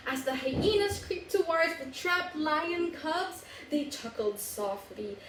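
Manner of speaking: usual speaking voice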